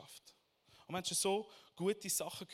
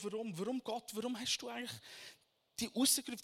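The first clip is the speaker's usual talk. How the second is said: ordinary talk, nothing marked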